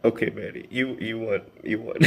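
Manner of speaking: ordinary talk, nothing marked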